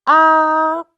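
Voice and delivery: "A" held high and level, tone one; a long flat high "A", no glide; first tone demo. Shown as a demonstration. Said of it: "is the first tone".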